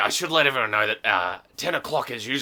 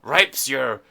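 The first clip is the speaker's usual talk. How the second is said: usual speaking voice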